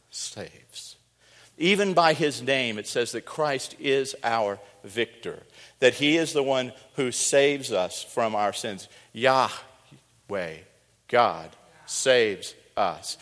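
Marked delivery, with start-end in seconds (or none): 0.1-1.0 saves breathed out unvoiced and soft; just breathe saves; whispered. Shown as a demonstration. Preacher to congregation, whispering the word saves. 9.1-13.2 God saves us drawn out very slowly, God stressed with a falling tune; slow emphatic. Shown as a demonstration. Preacher restating what Joshua means, slow and weighty.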